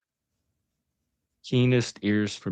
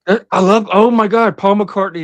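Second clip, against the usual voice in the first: gushing excitement; 10 semitones higher, 8 dB louder than usual. Real, not acted. Gushing about [name].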